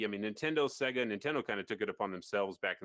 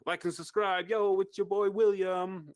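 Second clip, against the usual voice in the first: high, drawn-out YouTuber sign-on; William impression; 9 semitones higher, 5 dB louder than usual. Host imitates William's video intro, high hyped YouTuber voice. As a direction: pitch it way up and stretch the greeting. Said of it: "I think that's how William sounds".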